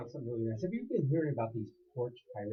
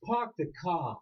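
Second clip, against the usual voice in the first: put-on Boston accent; 4 semitones higher, 3 dB louder, much slower than usual. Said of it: "They have this drawl".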